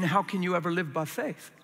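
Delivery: ordinary delivery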